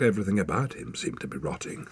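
ordinary delivery

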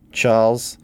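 usual speaking voice

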